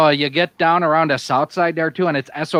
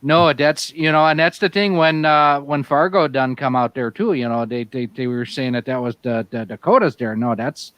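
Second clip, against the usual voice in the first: hammed-up Yooper Wisconsin accent, "you betcha there, eh?"; 3 semitones lower than usual. Bragging about deer camp to friends, Yooper accent. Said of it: "trying to do more Yooper or more Canadian".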